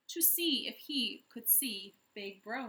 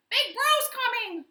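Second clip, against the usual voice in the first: much higher, louder parrot shriek; squawking parrot; 14 semitones higher, 13 dB louder than usual. A narrator's reading. Parrot squawks the news, high and loud. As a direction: squawk it out in alarm. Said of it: "squawked the parrot".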